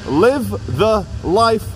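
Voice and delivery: slow, word-by-word read of a slogan. Shown as a demonstration. Reading the office wall's motto to viewers, slow and deliberate. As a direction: space out each word of the motto.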